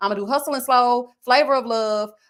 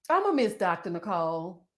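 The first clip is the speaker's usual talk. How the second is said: mock sentimental; 4 semitones lower, 6 dB quieter than usual. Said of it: "I'm up here talking about".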